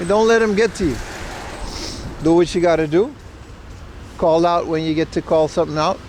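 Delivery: ordinary talk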